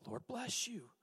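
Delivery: unvoiced hushed whisper; whispered blessing. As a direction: whisper it sweetly. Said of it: "Things are going well".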